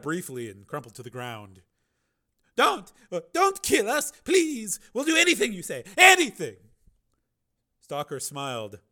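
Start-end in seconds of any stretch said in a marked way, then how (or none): 2.5-6.6 much higher, louder desperate pleading; terrified begging. A narrator's reading. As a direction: beg for your life in panic. Dwellers beg for life.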